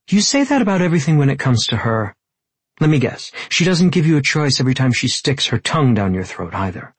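jealous accusation. Acted. Tessa accusing him over the other woman, jealous and angry.

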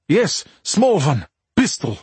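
put-on cab driver in thick Russian accent; urgent, broken English, heavy Russian accent. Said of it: "in a thick Russian accent".

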